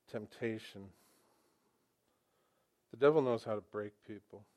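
normal talking voice

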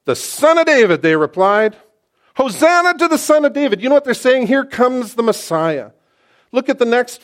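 loud, high-pitched burst of excitement; excited preaching. Real, not acted. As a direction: cry it out like a crowd hailing its king. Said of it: "I'm getting a little excited".

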